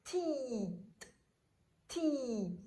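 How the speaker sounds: "ti" with a long held i; crisp T sliding into a stretched "ti"; syllable demonstration. Shown as a demonstration. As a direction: sound out "ti" for a beginning reader. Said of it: "pour lire cette syllabe".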